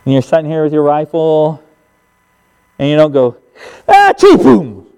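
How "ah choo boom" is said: high, loud yelp into a boom; mock hasty shot. Hunter jerks the rifle at the deer, mock hasty shot. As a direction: explode as if firing in a panic. Mimics careless jerky shooting.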